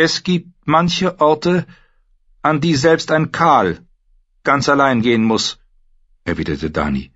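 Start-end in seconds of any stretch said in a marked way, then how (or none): 0.1-5.6 high, measured queen's voice; young queen. Acted. Dany tells her bloodriders she goes alone, high and firm.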